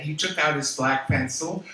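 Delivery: ordinary delivery, nothing marked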